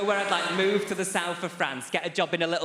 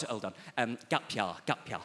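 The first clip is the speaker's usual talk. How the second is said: much softer, lower self-correction; quiet aside; 4 semitones lower, 6 dB quieter than usual. Real, not acted. Comedian correcting his own story onstage, under his breath. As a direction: mutter it to yourself.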